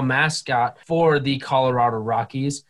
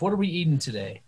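usual speaking voice

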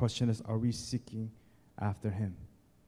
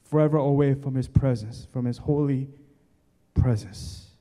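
forceful preaching; 5 semitones higher, 9 dB louder than usual. Acted. Preacher pleading at full force.

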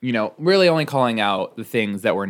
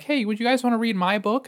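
high eager plea. Retold or imitated. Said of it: "now I'm like".

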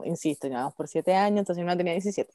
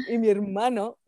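ordinary talk

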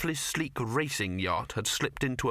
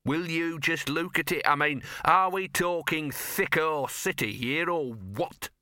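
higher-pitched, scornful dog voice; sneering; 6 semitones higher than usual. A narrator's reading. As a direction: sneer it in exasperation. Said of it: "sneered Gaspode".

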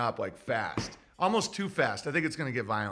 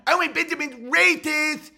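mocking critics; 10 semitones higher, 5 dB louder than usual.

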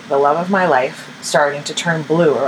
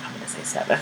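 hushed doctor quote; 10 dB quieter, faster than usual. Retold or imitated. Doctor's verdict quoted dryly.

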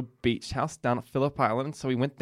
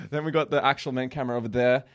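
normal talking voice

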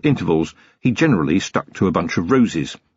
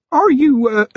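put-on much higher, louder, halting young constable; nervous; 4 dB louder than usual. A narrator's reading. Feeney behind Vimes in the dark, nervous. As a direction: hesitate anxiously in a high-pitched voice. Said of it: "came the nervous voice of Feeney".

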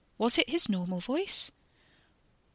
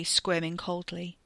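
ordinary delivery

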